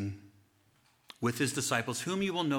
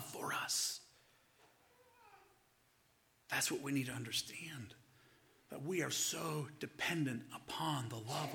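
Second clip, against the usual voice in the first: hushed preacher's whisper; 4 semitones higher, 3 dB quieter than usual. Acted. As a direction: drop to a hush. Drops to a whisper.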